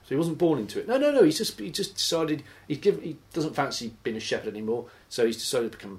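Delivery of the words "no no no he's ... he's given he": high loud no no no; mock protest. Mocking the self-made priest's defenders, loud high protest. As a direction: insist in a hammed-up squeal.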